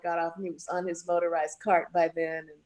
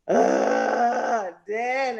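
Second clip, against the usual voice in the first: mock fan scream; 3 semitones higher, 4 dB louder, much slower than usual. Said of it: "they were screaming for him".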